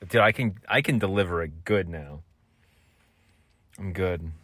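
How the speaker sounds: ordinary talk, nothing marked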